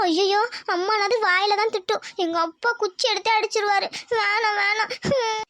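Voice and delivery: acted crying schoolgirl. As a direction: plead fearfully through sobs. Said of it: "அழுவாத, அழுவாத".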